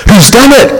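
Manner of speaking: mild alarmed cry; Susan crying out. Acted. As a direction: Susan calls out in shock. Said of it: "cried Susan".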